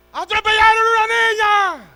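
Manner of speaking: high, drawn-out scream of bad news; full-force acted cry of alarm; alarmed shout. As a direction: yell it out to the whole congregation. Acts out alarm interrupting the service.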